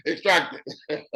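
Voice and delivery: laughing aside. Real, not acted. Jokes about Donald Trump.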